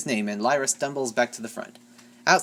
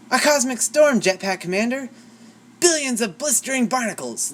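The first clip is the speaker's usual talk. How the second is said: alarmed cry; 10 semitones higher, 5 dB louder than usual. A narrator's reading. Pilot alarmed at storm.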